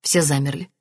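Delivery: ordinary delivery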